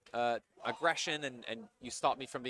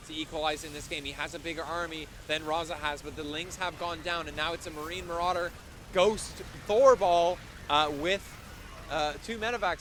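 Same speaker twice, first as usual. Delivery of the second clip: raised, driving esports commentary; live play-by-play; 3 semitones higher, 3 dB louder than usual. Real, not acted. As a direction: call the big attack as it unfolds, building higher.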